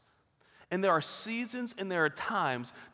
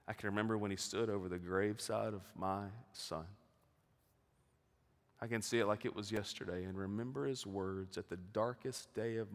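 low, much softer, somber storytelling; hushed grief; 9 semitones lower, 8 dB quieter than usual. Real, not acted. Pastor recalling his son's graveside, low and hushed. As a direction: let the loss sit in a low, soft voice.

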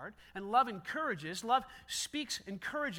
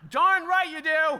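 loud, high, slow teasing jab; mock retort; 7 semitones higher, 14 dB louder, slower than usual. Pastor joking about what he could have said, loud teasing. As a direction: rub it in with a grin.

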